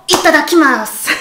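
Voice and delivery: itadakimasu in a cute, sweet little voice. Shown as a demonstration. Teacher showing the cutest way to say it, cute voice. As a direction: make itadakimasu sound adorable. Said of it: "la forme la plus mignonne".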